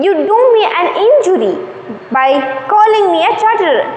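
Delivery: high, quick injured protest; protesting barber. Acted. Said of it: "the barber is telling that".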